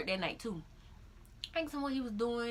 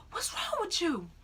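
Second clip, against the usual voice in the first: high-pitched mock alarm; worried, high retold question; imitated alarm; 10 semitones higher than usual. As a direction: imitate a startled boyfriend asking. Startled boyfriend quoted, almost wrecking.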